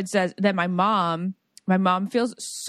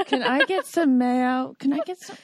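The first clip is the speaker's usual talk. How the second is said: mock customer order; 5 semitones higher than usual. Diner asking for some mayo, mock-polite. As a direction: act out asking a waiter, hammed up.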